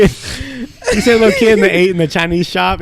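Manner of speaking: ordinary talk, nothing marked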